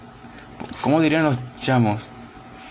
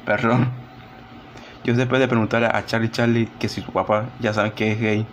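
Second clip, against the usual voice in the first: ordinary talk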